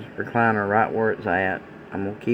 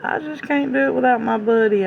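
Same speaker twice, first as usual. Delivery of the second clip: put-on high, louder tearful little girl's plea; much higher weeping child voice, imitated; imitated child crying; 14 semitones higher, 3 dB louder than usual. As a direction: play the sobbing grandchild begging to keep her buddy. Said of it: "I mean, just crying".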